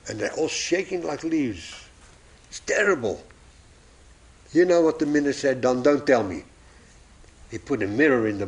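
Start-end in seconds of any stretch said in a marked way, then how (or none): none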